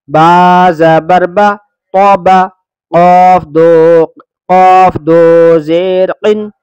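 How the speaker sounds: slow, drawn-out letter-by-letter chant; teacher reciting each syllable deliberately; spelling recitation. Acted. Teacher spelling the lesson for children, slow chant.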